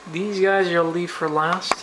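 ordinary talk, nothing marked

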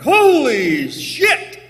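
horrified shout, drawn out slowly; high loud shocked cry. Retold or imitated. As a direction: gasp in shock at the sad beard. Reacting to a patchy beard.

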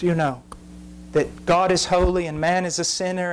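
ordinary talk

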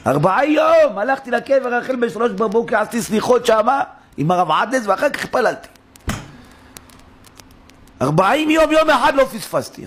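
high, emphatic imitation of her protest; retold insistence. Retold woman insisting on her forty days.

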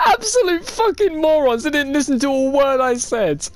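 high-pitched, incredulous ranting; exasperated rant. Real, not acted. Player ranting that teammates ignored him, high exasperated outburst. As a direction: wail about being ignored. Rants after losing the vote.

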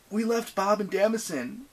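high, strained character voice; croak. A narrator's reading. Percy to Annabeth about abandoning their friends, croaking. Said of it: "Percy croaked".

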